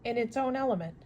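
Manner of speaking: ordinary delivery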